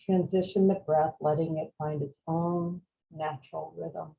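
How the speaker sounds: calm, unhurried meditation voice with long pauses; guided meditation. Real, not acted. Teacher guiding a group to follow the breath, calm meditation voice. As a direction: guide them gently through each breath, leaving space.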